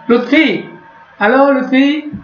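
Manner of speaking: high, ringing name call. Real, not acted. Calling another student online. (memanggil nama dengan nada tinggi)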